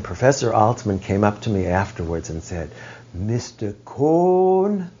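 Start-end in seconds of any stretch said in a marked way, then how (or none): 3.1-4.9 put-on slow, higher "Mister Cohn" in a German accent. Old professor greeting the speaker, in a German accent. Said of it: "with his German accent".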